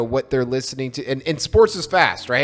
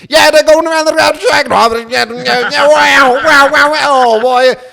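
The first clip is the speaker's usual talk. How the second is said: loud, much higher Southern drawl race call; Southern accent NASCAR announcer; 12 semitones higher, 13 dB louder, slower than usual. Retold or imitated. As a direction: gush like a Southern NASCAR commentator. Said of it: "in such a strong Southern accent".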